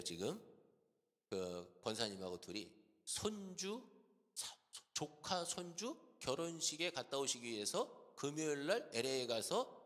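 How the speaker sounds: normal talking voice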